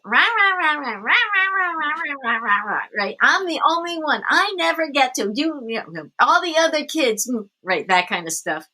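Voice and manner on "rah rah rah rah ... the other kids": put-on whiny high-pitched child complaining, swooping up and down; mock childish whining in a sing-song wail; hissy fit. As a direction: mimic a kid's griping rant. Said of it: "she was having a hissy fit".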